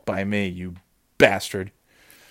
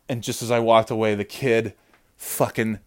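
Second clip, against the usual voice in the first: usual speaking voice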